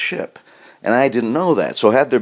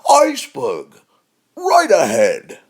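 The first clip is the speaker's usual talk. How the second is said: cheering tone; 8 semitones higher, slower than usual. Pretend. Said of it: "Sounds like you're rooting for it".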